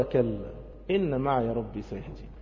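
slow, hushed and low; calm assurance. Acted. Moses reassures his people by the sea, calm assurance. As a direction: answer softly with settled trust.

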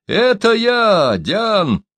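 high, drawn-out identification; storyteller voicing a shouted answer; calling out. A narrator's reading. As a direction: announce yourself high across a distance. Calling back to the sentry.

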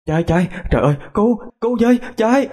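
high-pitched panicked cries, no added volume; panicked cry for help. Acted. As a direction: cry out to flee and be rescued. Screams for help in fire nightmare. (kêu cứu hoảng hốt, giọng cao)

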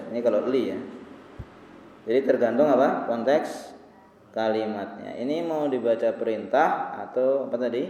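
ordinary talk, nothing marked

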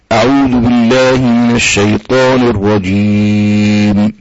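slow, low, measured tajweed chant; solemn Quranic recitation. Shown as a demonstration. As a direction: recite it slowly, drawing out each vowel.

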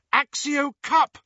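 high, loud spell cry. Acted. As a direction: fling the spell out in desperation. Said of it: "cried Hermione".

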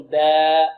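با with a thin, fronted long a, held; drawn-out light با, no heaviness; light alif. Shown as a demonstration. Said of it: "وإذا كان ما قبله مرقق".

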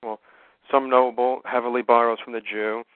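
normal talking voice

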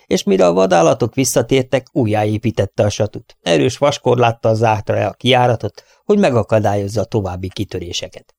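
plain reading voice

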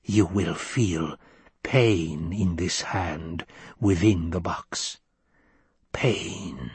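low, slow, hushed menace; slow, low near-whisper of a threat. Acted. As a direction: lean in and hush it, slow and low. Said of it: "lowered her voice almost to a whisper".

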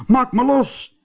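very high-pitched cry to be freed; called out. A narrator's reading. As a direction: cry out high, stuck fast. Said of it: "riep hij". (heel hoog uitgeroepen)

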